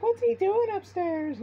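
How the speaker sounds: very high, slow falsetto mom; mom's voice. Retold or imitated. Mock high mom voice to the narrator. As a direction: squeak it out like his mom asking. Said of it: "his mom was just like".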